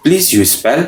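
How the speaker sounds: ordinary talk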